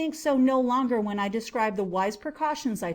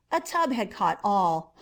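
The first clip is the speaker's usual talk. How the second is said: usual speaking voice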